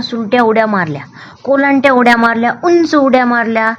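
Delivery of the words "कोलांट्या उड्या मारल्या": ordinary delivery, nothing marked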